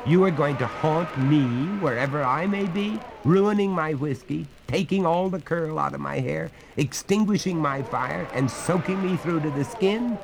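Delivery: rising high and agitated, outraged; indignant demand. Acted. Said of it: "demanded the master".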